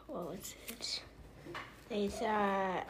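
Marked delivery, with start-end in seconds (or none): 0.1-1.0 soft unvoiced whisper; whisper to self. Real, not acted. Child trying to recall the story, whispering to herself. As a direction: breathe the question to yourself while trying to remember. Child searches memory mid-retelling.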